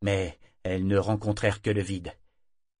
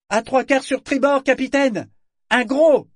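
very high, loud call down to the deck; lookout's shout; 10 dB louder than usual. Acted. Altea calls the serpent's position to the captain, shouting. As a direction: bellow the bearing down.

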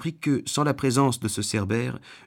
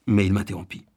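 ordinary delivery, nothing marked

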